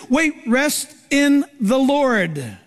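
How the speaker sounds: normal talking voice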